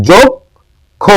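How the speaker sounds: যক্ষ high, split "jok... kho"; pronunciation demo. Shown as a demonstration. Teacher giving an example of the rule, যক্ষ syllable by syllable. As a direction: stretch যক্ষ in two beats with a closed "o". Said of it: "অ এর উচ্চারণটা ওকারের মতো".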